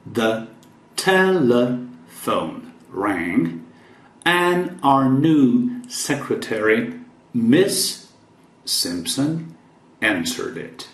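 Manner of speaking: slow, careful read for learners; "telephone" drawn out slowly; slow model reading. Shown as a demonstration. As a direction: stretch each word so students can follow. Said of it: "teacher reads sentence slowly again".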